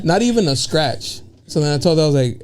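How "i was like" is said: ordinary talk, nothing marked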